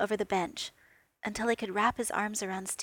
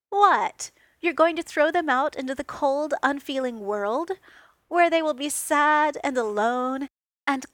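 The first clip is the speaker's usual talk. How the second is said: higher and much louder, hammed-up indignation; mock outrage; 5 semitones higher, 7 dB louder than usual. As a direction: play the wounded drama queen. Tony's mock outrage over Roombas.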